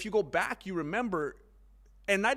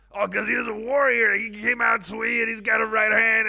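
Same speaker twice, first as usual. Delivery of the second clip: loud shouted mock fighter excuse, higher; 5 semitones higher, 9 dB louder than usual. Mocking a fighter excusing his poor win, loud. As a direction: hype up the excuse. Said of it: "How come you didn't look better".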